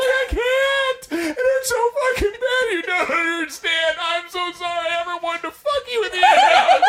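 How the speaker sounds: high, loud, half-laughing cry of pain; hammed-up agony; mock pained wail. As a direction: whine it like you're dying. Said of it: "I'm like".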